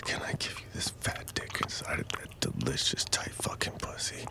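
soft pleading whisper. Acted. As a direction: breathe it close to the ear.

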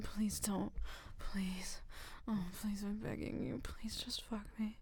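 soft, unvoiced pleading; whispered begging. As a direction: beg quietly, almost breathless.

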